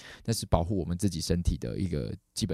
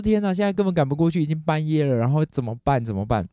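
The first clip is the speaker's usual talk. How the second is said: high, loud fretting that sinks as it goes; retold desperation, starting high and strained; panicked; 7 semitones higher, 5 dB louder than usual. Owner learns clinic is in Tainan, panicked. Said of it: "我就更紧张".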